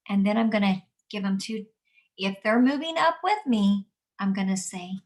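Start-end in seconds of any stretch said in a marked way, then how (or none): none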